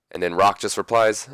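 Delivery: ordinary delivery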